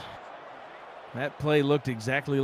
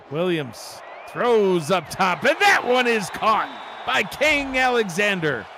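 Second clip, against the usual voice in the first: rising, louder call on the catch; excited play call; 5 semitones higher, 4 dB louder, slower than usual. Real, not acted. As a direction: build it up as the pass lands. Live play call, big catch.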